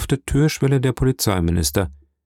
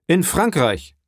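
higher cry of disbelief; startled royal outburst, pitch raised; shocked exclamation; 8 semitones higher than usual. A narrator's reading. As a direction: exclaim it as if struck by bad news. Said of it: "rief er".

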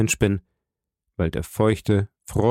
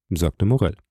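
ordinary delivery, nothing marked

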